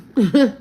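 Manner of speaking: short loud laugh; burst of laughter. Real, not acted. Laughs after not sorry.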